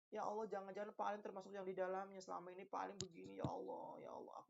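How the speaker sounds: soft, fading worried plea; a touch of anxious supplication; fearful prayer. Retold or imitated. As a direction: pray in quiet dread. Preacher recalls his own fearful prayer.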